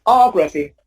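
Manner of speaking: Ography as OG-ra-phy; demonstrated OG-raphy ending; stress before graphy. Shown as a demonstration. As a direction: punch the opening O of Ography. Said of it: "the stress falls before graphy".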